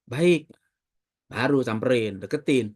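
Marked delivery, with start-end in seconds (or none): 0.1-0.5 polite reply. Retold or imitated.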